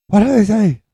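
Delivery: very high, loud yell as mom; exasperated demand. Retold or imitated. Mom demanding the news from deliberate dad, exasperated yell. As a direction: burst out shouting, imitating her impatience. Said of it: "it would just drive her nuts".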